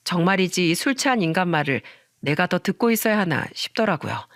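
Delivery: plain reading voice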